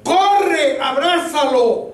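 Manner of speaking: high-pitched urgent command, stretched out; mysterious voice calling high. Retold or imitated. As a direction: belt out the order as the insistent voice. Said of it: "la voz que me dice otra vez".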